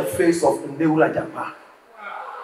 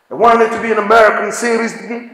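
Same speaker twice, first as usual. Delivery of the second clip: put-on quick light American-accented "I wanted to be an American citizen"; American accent imitation; 3 semitones higher, faster than usual. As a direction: rattle it off like an American. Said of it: "You know how Americans speak".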